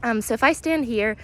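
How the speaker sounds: normal talking voice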